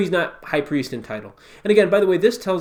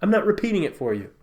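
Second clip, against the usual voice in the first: higher, dismissive comeback; exasperated retort; 7 semitones higher than usual. Retold or imitated. Jesus to the high priest, exasperated. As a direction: brush off a question he knows they can answer. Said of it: "hear the ridiculousness of the situation".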